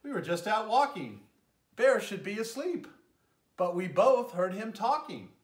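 a bouncy hare explaining; hare talking. Acted. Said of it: "says the hare".

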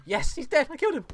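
high, loud cry of victory; gleeful yelling over a win; triumphant whoop. Real, not acted. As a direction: cheer at the kill. Just killed enemy, celebrating.

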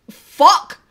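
slow, loud, punched words; drawn-out playful battle cry; mock-defiant declaration. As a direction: declare it loudly, word by word. Mock-militant slogan before laughing.

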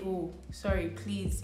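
ordinary talk, nothing marked

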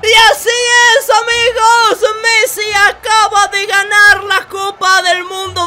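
high, loud commentator's proclamation; triumphant announcer. Acted. Gamer announces Messi's cup win to viewers, triumphant shout. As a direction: call the final win to the crowd.

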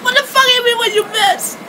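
high, loud tearful accusation; emotional outburst. Real, not acted. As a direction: lash out through tears. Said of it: "I'm in an emotional state".

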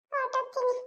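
a ghost's farewell echoing into the distance; fading ghost echo. Acted. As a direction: let the word drift off like a departing spirit. Ghost voice fades away.

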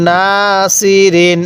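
না held long, then level সি রিন; recitation model. Shown as a demonstration. Teacher showing how to read, drawn-out না সি রিন. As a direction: stretch না and chant সি রিন evenly. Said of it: "এক আলিফ টানতে হবে".

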